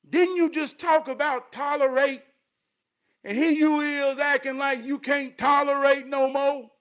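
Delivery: loud, high-pitched self-reproach; scolding himself. Acted. Taking himself to task over tolerance, scolding. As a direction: call yourself out, loud and high.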